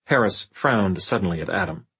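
ordinary delivery, nothing marked

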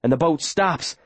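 usual speaking voice

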